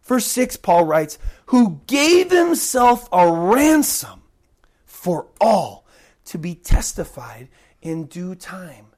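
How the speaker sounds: ordinary talk, nothing marked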